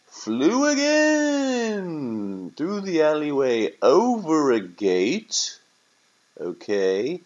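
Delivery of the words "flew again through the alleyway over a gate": high, loud, drawn-out storyteller flourish; dramatic narration. A narrator's reading. Narrator telling the turkey's escape, dramatic and soaring. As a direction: stretch the words out in a soaring cry.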